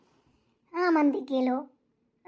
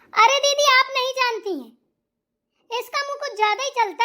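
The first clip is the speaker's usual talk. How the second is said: loud, higher complaint; loud appeal; 7 semitones higher, 7 dB louder than usual. Acted. Mother-in-law complains loudly to the aunt, high-pitched. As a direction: call out to her sister-in-law.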